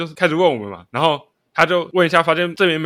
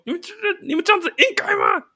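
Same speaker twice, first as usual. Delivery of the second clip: scolding teacher; 14 semitones higher than usual. Retold or imitated. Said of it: "我就记得他中间有一句就是说".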